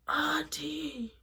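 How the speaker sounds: weak, airy high-pitched plea; slurred, quiet calling. Retold or imitated. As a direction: imitate calling out with barely any voice. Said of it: "my voice was all slurred and, like, quiet".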